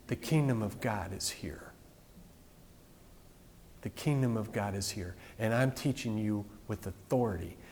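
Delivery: low, soft, solemn declaration; hushed proclamation. Retold or imitated. Preacher voices Jesus announcing the kingdom, hushed and solemn. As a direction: say it low and soft, with weighty pauses.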